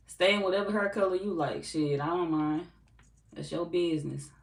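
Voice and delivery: usual speaking voice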